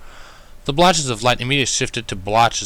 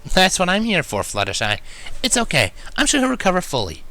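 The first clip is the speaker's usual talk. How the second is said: gentle reassurance; 9 semitones higher than usual. A narrator's reading. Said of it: "she assured gently".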